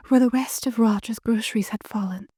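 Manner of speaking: haunted diary writer. Acted. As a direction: read it as the frightened wife writing. Reads diary as Barbara.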